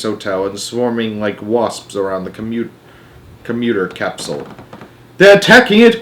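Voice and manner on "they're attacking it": high, loud outcry; alarmed cry. A narrator's reading. Boy watching the capsule attacked, crying out. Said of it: "cried Charlie".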